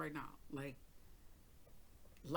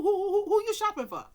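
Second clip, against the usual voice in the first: incredulous demand; 11 dB louder than usual. Retold or imitated. Grilling brother on jail call.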